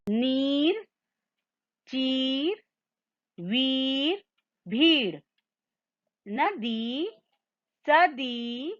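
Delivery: slow word list from "नीर" to "शीशी", each ई held long; repeat-after-me demo. Shown as a demonstration. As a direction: draw out every ई, as in "नदी", word by word for pupils. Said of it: "आप भी मेरे संग संग दोहराइए".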